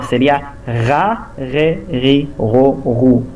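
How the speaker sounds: throaty Resh R on Ra, Re, Ri, Ro, Ru; "Ra", "Re", "Ri" with R scraped from the throat; guttural R syllables. Shown as a demonstration. Teacher demonstrating the guttural Resh to students. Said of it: "dependendo da vogal".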